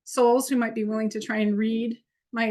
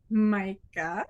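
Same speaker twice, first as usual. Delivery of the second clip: ordinary talk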